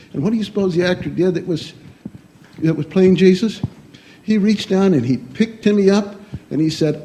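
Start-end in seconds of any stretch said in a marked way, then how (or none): none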